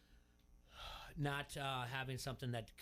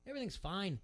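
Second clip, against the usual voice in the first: mock breezy reassurance; 10 semitones higher than usual. Telling a worried friend everything's fine, higher and mocking. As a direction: play the carefree patriot waving off worries. Retelling his old dismissive reassurance.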